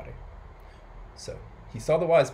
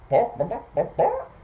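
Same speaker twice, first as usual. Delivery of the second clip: high, staccato bok-boks; hen clucking; 8 semitones higher, 6 dB louder than usual. A narrator's reading. Storyteller voicing the silly old hen, clucking. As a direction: imitate a hen, ending on a higher squawk. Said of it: "and the hen clucking".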